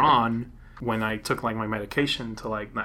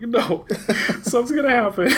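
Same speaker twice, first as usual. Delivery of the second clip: panicked laugh; 12 semitones higher than usual. Retold or imitated. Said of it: "I was like".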